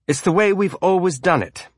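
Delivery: a touch of anger, on the defensive; defensive, hint of anger. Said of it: "the defensiveness in the man's voice, the hint of anger".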